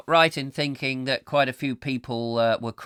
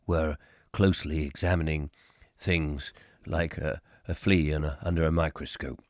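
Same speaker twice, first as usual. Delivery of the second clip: Richard Burton; 7 semitones lower than usual. Acted. Host recalls [name] opening, as a low Burton impression. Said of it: "That was my Richard Burton impression".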